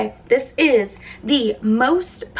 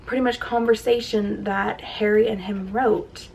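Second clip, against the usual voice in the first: normal talking voice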